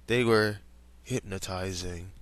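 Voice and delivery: plain reading voice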